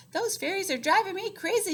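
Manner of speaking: put-on high, loud, frazzled old woman; exasperated yell. Old woman yelling about the fairies, exasperated. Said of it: "she yelled".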